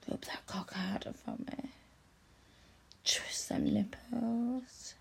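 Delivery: hushed urging whisper; whispered commands, urging. Acted. Intimate instructions, whispered.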